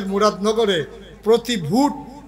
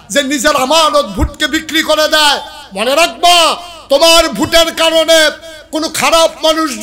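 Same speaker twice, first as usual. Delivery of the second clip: raised, ringing sermon voice; high-pitched preacher's admonition, growing louder; fervent preaching; 6 semitones higher, 6 dB louder than usual. Real, not acted. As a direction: challenge the congregation, building to a loud warning. Preaching at full force.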